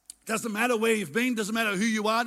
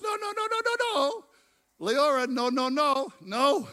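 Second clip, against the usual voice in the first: much higher, urgent repeated no; urgent calls of no; 5 semitones higher than usual. Real, not acted. Preacher calling out to Leora, urgent. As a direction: stop someone at once. Sudden shouted interruption.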